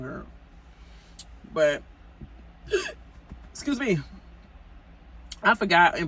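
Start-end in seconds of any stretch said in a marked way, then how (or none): 2.6-3.5 high unvoiced gasp of shock; sudden high-pitched gasp; sharp gasp. Real, not acted. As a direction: gasp like something startled you.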